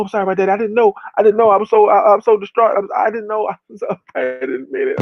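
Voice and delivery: high-pitched, distraught apology; mock tearful pleading. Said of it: "no goddamn crocodile tears".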